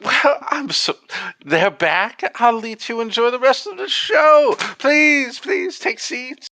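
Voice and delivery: very high, fussy woman's voice; gracious hostess. Acted. Game master as the woman offering guests seats, high gracious falsetto. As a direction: usher guests along in a sweet falsetto. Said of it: "As the woman stands up".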